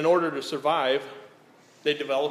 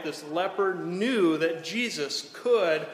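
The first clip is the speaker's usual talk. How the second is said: usual speaking voice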